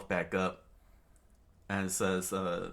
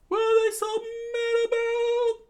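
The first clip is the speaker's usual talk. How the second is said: squeaky puzzled cry; 8 dB louder than usual. Acted. Character fleeing the Lupins, in a high squeal. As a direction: whine it in a tiny cartoon voice.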